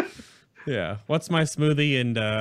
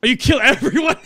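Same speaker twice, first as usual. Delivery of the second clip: surprised laugh; 13 semitones higher, 7 dB louder, faster than usual. Real, not acted. Surprised by raid tactic.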